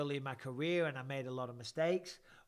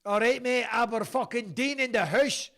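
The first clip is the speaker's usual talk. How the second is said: hammed-up Scottish shout, high and loud; Aberdeen accent; 7 semitones higher, 10 dB louder than usual. Host shouting out Aberdeen, in a loud Scottish accent. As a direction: bellow it in a mock Aberdeen accent.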